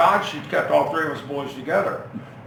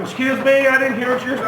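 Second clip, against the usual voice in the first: higher, louder mock-stern mother; stern mom; 9 semitones higher, 7 dB louder than usual. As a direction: dare the kid to repeat it. Mom catches kid muttering back.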